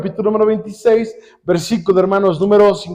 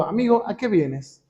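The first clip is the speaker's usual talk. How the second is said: softer, tender question to a friend; loving, merciful; 8 dB quieter than usual. Acted. Preacher voices Jesus greeting his betrayer, soft and loving. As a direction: say it softly, with mercy. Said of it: "amoroso, un tono misericordioso".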